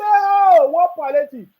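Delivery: high, loud scoffing mimic; mocking critics. Vlogger mimicking critics scoffing at palliative. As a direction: parody the whining critics. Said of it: "they say".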